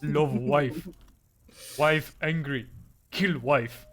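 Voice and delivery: low, loud caveman-like monkey chant; monkey meme voice. Acted. Friend acting out the wife monkey meme, low and loud. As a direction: grunt it in broken, two-word bursts. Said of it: "the wife monkey meme".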